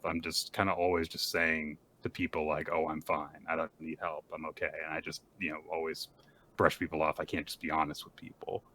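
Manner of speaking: soft, low private thought; inner monologue. Retold or imitated. Sick boy privately admitting he brushes people off, hushed musing. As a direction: voice it quietly, as if to yourself. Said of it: "he thinks to himself".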